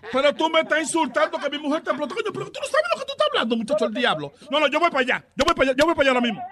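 high-pitched angry protest, racing at the end; indignant outburst. Real, not acted. Insulted husband threatening to come over, indignant and high-pitched. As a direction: explode in offence, then hurry out the threat.

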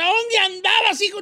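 scolding wife. Acted.

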